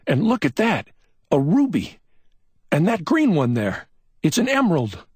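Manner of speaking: high, thrilled, pointing out each gem; rising delight at every stone; excited discovery. A narrator's reading. McMain pawing through glass and finding gems, thrilled.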